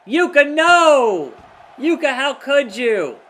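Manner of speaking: loud, high, dragged-out cry of betrayal; hammed-up anguished cry at Yuka; mock betrayal. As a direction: wail at the traitor Yuka.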